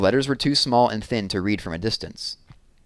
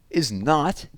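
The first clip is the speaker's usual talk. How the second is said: put-on higher, defiant little girl; 7 semitones higher than usual. Jessie denies being freaky to Scott, higher defiant girl voice. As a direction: stick your chin out and deny it. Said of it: "sticking her chin out".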